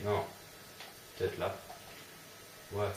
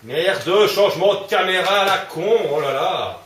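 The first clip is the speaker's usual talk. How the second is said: angry shout; 8 semitones higher, 9 dB louder than usual. Real, not acted. Furious at camera angle.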